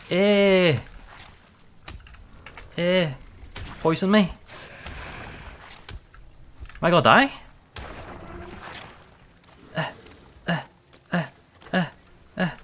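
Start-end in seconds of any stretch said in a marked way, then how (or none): 0.1-7.3 scared of dying in a fight; real panic under attack; panicked. Real, not acted. Taking damage in fight.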